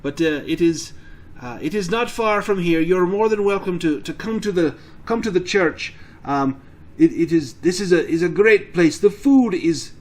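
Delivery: chatty Irish priest with halting starts; Irish accent. Acted. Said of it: "Why I sound Irish".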